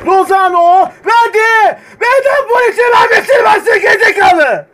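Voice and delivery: frantic shouting. Real, not acted. Gamer screaming at his team during an attack, frantic shout. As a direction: scream the instructions at the screen.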